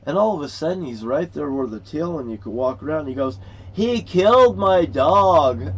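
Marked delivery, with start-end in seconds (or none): 3.7-5.7 retold yell, loud and drawn out; upset outburst. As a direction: imitate a high, slow holler of protest. Retelling an upset man's outburst.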